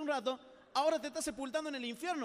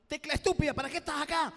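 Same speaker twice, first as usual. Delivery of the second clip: angry at the key; 4 semitones higher, 8 dB louder than usual. Retold or imitated. Man attacking a computer key.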